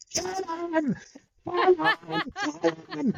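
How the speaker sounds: high-pitched imitation of chanting kids; baying children. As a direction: mimic a bloodthirsty young audience, laughing. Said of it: "these kids are going".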